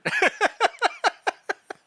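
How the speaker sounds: loud, high giggling fit; high burst of laughter. Real, not acted. Player cracking up at the guard's short name, laughing. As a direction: crack up at the joke.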